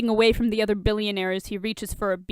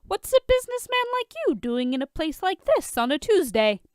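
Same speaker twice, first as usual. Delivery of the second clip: awkward; 9 semitones higher, 4 dB louder than usual. A narrator's reading. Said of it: "Oliver says awkwardly".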